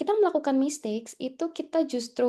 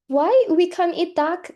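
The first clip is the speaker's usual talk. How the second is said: higher, louder, drawn-out question; puzzled protest; 5 semitones higher, 6 dB louder, slower than usual. Retold or imitated. Housemate asks why duck is forbidden, puzzled retold protest. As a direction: act out a baffled friend, slowly.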